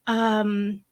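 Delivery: hesitant, slightly high coy question; coy, flirtatious. Retold or imitated. Client responds to vet's doggy date offer, mildly coy. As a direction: lightly imitate flirty hesitation. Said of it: "how coy and flirtatious I was being".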